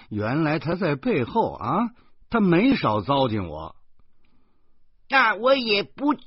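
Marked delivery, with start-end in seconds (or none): 5.1-6.2 put-on much higher little-girl voice, flat refusal; a kid digging in her heels; stubborn child refusal. Girl refusing to come down from the railing, stubborn.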